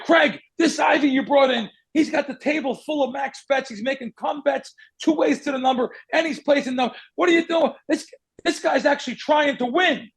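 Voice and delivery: a hammed-up boss chewing out an employee; mock yelling. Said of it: "He starts yelling at Craig".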